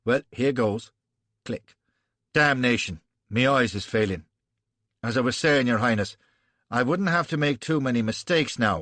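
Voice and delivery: blustering Irish general; Irish brogue. Acted. Gough explaining his odds to the prince, Irish brogue. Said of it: "pigsty brogue".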